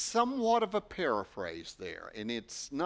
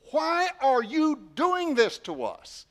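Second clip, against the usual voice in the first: much higher and louder, DOING pushed hard; anguished mother; 10 semitones higher, 8 dB louder than usual. Acted. Mary confronting her son at the temple, anguished and loud. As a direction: cry it out as a frantic mother. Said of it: "She was full of emotion and, and passion".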